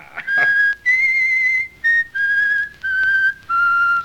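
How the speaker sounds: a long, piercing whistled melody; shrill whistled tune. Acted. William whistling in the dressing room, piercing and teasing. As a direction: whistle a tune to needle your friend. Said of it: "I can't stand that whistling".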